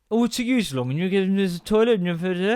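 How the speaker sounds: imitating a son's slurred, swallowed sentence; garbled mumbling. Son garbles a complaint about toilet time, mumbled. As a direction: run the words into unintelligible mush. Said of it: "that wasn't a sentence you've just said".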